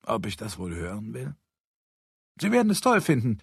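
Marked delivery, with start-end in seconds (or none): 2.4-3.4 high, keen explainer voice; lightly voiced know-it-all; eager Harry.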